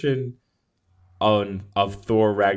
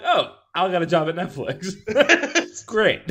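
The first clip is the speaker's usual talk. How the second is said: higher, laughing mock remark; 9 semitones higher than usual. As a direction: tease through a laugh. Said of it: "I'm like".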